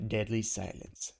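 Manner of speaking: ordinary delivery, nothing marked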